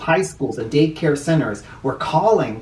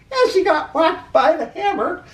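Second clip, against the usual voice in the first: high, laughing punchline; high giggly aside; gleeful; 14 semitones higher than usual. Acted. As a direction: squeal it with delight. Reader gleefully adds punchline.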